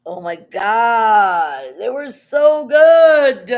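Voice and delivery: high, loud, drawn-out rave about the chips; gushing. Real, not acted. Vlogger raving to viewers about salsa and chips, gushing slowly. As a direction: stretch every word like it was heaven.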